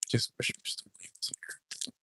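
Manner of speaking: quick unvoiced whisper. Real, not acted. Vlogger whispering to viewers that he misses Mister Lee. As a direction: whisper the wish as a fast aside. Wistful aside, voice dropped.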